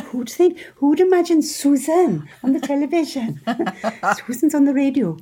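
high, incredulous auntie voice; imitating an appalled auntie. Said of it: "She'd say".

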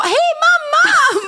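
put-on high, loud, slow sing-song child's greeting; cheerful kids' greeting. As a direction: chirp it like a happy little kid. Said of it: "de bara". (glatt barnsligt hej, högt och utdraget)

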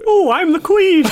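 very high put-on royal voice; hammy falsetto queen; queen impression. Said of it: "Can you do an impression of the queen?".

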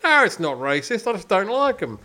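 put-on light Australian accent, landlord insisting; stubborn Aussie landlord. Landlord denying the racism, in a light Australian accent. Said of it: "I'm gonna do my Australian accent again".

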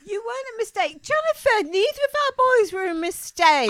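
loud, high, outraged denial; indignant protest. Real, not acted. Mother loudly insists to son he was wanted. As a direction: cry out that no son was a mistake.

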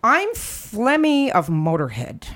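ordinary delivery

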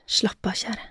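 murmured. A narrator's reading. Man telling his dear one to relax, murmured. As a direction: murmur it softly to soothe. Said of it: "mumlet han".

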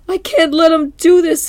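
high, loud frantic put-on voice; mock frantic Krabs. Mr. Krabs panicking behind the door, high frantic. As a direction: squeal it in panic. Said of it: "He goes for the door".

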